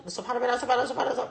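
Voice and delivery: سبحان ربي الأعلى gabbled fast, words swallowed; rushed tasbih. Shown as a demonstration. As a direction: rattle it off without finishing the words. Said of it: "ما كملش الكلمة، ما ينفعش". (تسبيح سريع بلع الكلمات)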